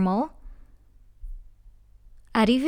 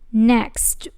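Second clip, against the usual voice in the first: normal talking voice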